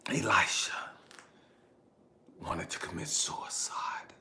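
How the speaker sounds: low, very soft, grave quote; hushed retold revelation. Ex-wife reveals their son wanted suicide, hushed. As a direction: let the shocking words fall quietly. Retells ex-wife's grave remark.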